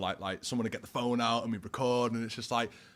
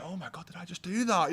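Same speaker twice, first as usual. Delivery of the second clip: amazed at himself; 7 semitones higher than usual. Retold or imitated. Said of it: "And I'm like".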